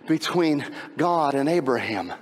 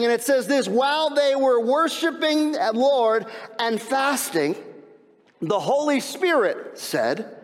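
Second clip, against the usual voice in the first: raised, driving preacher pitch; impassioned preaching; 7 semitones higher than usual. Real, not acted. Preacher recounting worship in the church of Antioch, impassioned preaching. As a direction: carry the congregation into the scripture reading.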